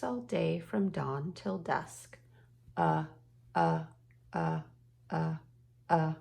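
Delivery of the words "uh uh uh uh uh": "uh" chugged loud like a tugboat engine; tugboat chugging u. Shown as a demonstration. Teacher chants the tugboat sound for the class, chugging. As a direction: chug out "uh" like Tubby the tugboat. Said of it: "the letter U says, uh".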